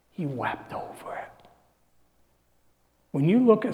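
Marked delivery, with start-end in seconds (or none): 0.1-1.5 soft unvoiced repeat; hushed echo of scripture; whispered. Real, not acted. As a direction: whisper he wept over it. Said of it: "repeats whispered".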